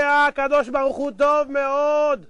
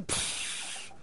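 long, soft deflating hiss; voiceless hiss like bus doors; air let out; 16 dB quieter, much slower than usual. Acted. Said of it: "כולם מוציאים אוויר".

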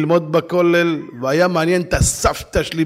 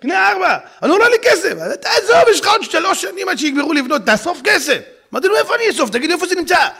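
higher, louder back and forth; heated haggling; 6 dB louder, faster than usual. Retold or imitated. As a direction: argue loudly over the money. Heated haggling both sides. (ויכוח קולני בקול גבוה)

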